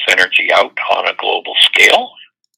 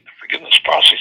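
ordinary talk, nothing marked

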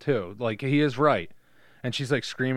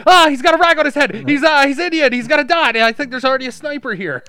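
high, loud, fast panicked shout; panicked yelling; 12 semitones higher, 13 dB louder than usual. Retold or imitated. Girl describing another man, yelling fast. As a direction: rattle it off in a panic. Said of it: "it goes the other way".

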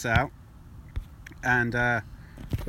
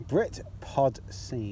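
said slowly; much slower than usual. Shown as a demonstration. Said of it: "'Cause I gotta say it slowly".

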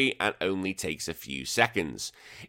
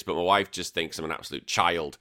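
ordinary talk

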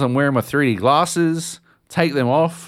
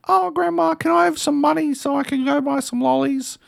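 put-on much higher, little kid begging; whiny kid voice. Kid begging grandma for lolly money, high whiny voice. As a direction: squeak it out like a kid asking for lollies. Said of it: "he's like".

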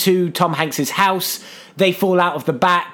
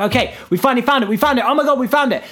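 excited; 6 semitones higher, faster than usual. Acted. Excited discovery in retold scene.

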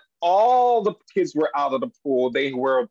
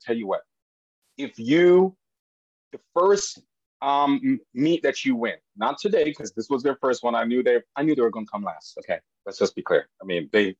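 ordinary delivery

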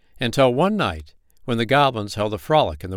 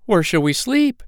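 higher and louder plaintive question; worried goblin; 10 semitones higher, 5 dB louder than usual. Acted. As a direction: whine it anxiously. Tired goblins ask.

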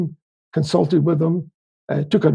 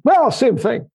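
higher mock dismissive barber; imitating the barber; 7 semitones higher, slower than usual. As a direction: brush it off like the barber. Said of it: "He says".

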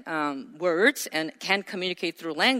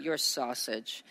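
low put-down; 7 semitones lower, 9 dB quieter than usual. Shown as a demonstration. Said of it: "instead of".

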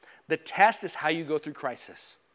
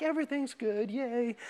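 mock fake cheer; 4 semitones higher, 8 dB quieter than usual. Mocking shallow church cheer.

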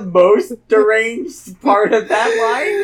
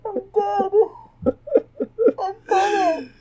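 high, weak, spent from laughing; laughed out; 11 semitones higher, 3 dB quieter than usual. Real, not acted. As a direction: gasp it out, wiped out. Collapsed from laughing.

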